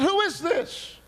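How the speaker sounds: high, loud question; alarmed demand. Retold or imitated. Demands the unknown voice identify itself.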